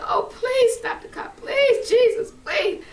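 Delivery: high, loud pleading, imitating Mama; mother helpless with laughter. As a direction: beg at full voice, as if overcome with hilarity. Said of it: "she was laughing so hard".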